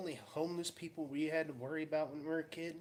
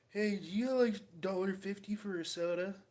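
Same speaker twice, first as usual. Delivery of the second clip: tweaker woman voice; 8 semitones higher, 7 dB louder than usual. Retold or imitated. Retelling tweaker woman's ask.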